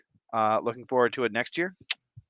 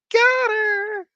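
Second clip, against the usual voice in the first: much higher, squeaky repeat of "Got her"; 6 dB louder than usual. Shown as a demonstration.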